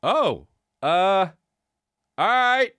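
a startled, squeaky all right; surprised high okay. Retold or imitated. Fan answering a costumed worker, surprised and high. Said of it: "And I say".

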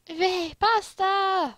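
loud, high, drawn-out cheer; gleeful Italy. Acted. Italy interrupts the meeting for pasta, gleeful cry. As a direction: sing out the delight in a high voice.

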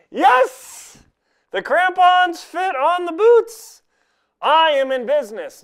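high, loud cheer; triumphant. Real, not acted. Vlogger cheering that the crampons fit, high and triumphant. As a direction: celebrate like you just summited.

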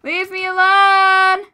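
long drawn-out loud scream; panicking gamer. Real, not acted. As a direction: stretch it out, slow, screaming in panic. Fleeing attacker, panicked.

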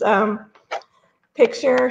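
ordinary delivery

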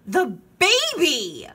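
high, drawn-out alarmed yell. A narrator's reading. Child worried for missing sister, loud yell. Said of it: "I yelled, louder than anyone".

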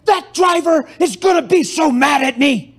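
panicked cry. Retold or imitated. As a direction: panic about the other driver. Retold panicked woman.

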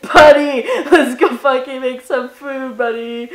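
loud, playful shout to a buddy; excited call. Pretend. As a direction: burst out with a rowdy invitation. Calling out to imagined buddy.

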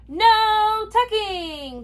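high, long drawn-out cry; crew cry. A narrator's reading. Crew backs the captain's refusal, high drawn-out cry. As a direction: stretch it out like a chorus. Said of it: "the crew cried".

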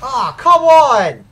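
loud, drawn-out protest; exasperated shout. Real, not acted. Gamer to losing team, exasperated shout. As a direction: cry out in slow, loud exasperation.

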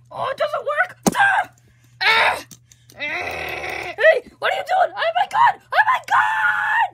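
put-on panicked zombie kid. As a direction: scream in wild alarm. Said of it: "I'm the kid who ... zombie".

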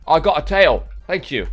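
ordinary delivery

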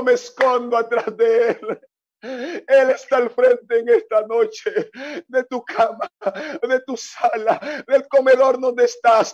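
fervent preacher's cadence; preaching. Acted. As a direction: preach it out with passion. Preaching with music. (predicación ferviente)

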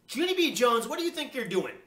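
loud, high, cross mother; angry growled scolding. Acted. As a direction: scold the child sharply and loudly. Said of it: "she growled".

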